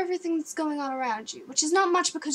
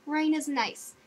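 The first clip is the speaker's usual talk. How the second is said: self-soothing; 6 semitones higher than usual. Retold or imitated. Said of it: "I'm like".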